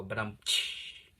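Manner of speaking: slow "But, um" capped with a mouth cymbal tsh; rimshot gag. Shown as a demonstration. Narrator mocks his own filler words with a slow rimshot. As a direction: deliver it like a punchline with a drum hit. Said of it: "I always feel like I'm supposed to go".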